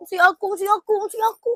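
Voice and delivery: loud, high and giggly; teasing sing-song claim; playful. Real, not acted. Claiming the gift for herself, giggly and high.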